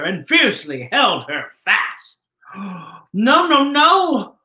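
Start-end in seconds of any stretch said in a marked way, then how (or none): 2.5-4.4 gasp, then a rising, louder no, no, no; mock-horrified gasp at the capture. Narrator reacts to fly's capture.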